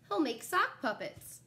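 ordinary delivery

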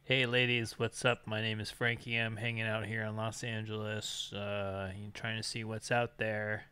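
soft, low, slow smooth-talker greeting; smooth pickup voice. Acted. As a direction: play it cool like a ladies' man. Recording a dating chat greeting.

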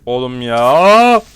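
loud, very slow "Oğlum ya"; exasperated. Real, not acted. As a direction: drag it out in disbelief. Exasperated drawn-out groan.

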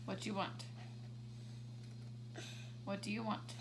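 ordinary talk, nothing marked

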